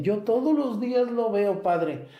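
high imitation of an old parishioner; old lady voice. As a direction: play a pleading old woman asking for medicine. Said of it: "Hay viejillas o viejillos que me dicen". (viejita que suplica por la medicina, voz aguda imitada)

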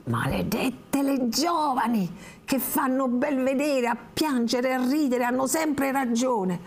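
put-on high, slow, spiteful old-woman voice; muttering through teeth. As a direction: curse the young ones slowly, like a bitter old crone. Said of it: "continuò mormorando tra i denti". (la vecchia borbotta tra i denti, lenta e acida)

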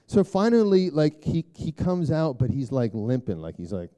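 usual speaking voice

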